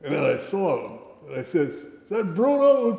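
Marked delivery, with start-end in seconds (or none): 1.7-3.0 high, loud, drawn-out astonishment; retold incredulous question; amazed recognition. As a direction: stretch it out as the shock lands. Retold shock at recognising the general.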